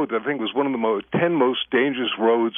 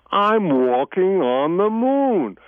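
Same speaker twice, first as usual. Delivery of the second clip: slow, drawn-out higher-pitched imitation; Neil Armstrong; 6 semitones higher, much slower than usual. Guest imitating a backwards-played astronaut recording, slow eerie impression. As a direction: stretch it out like speech played backwards. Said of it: "it's Neil Armstrong going".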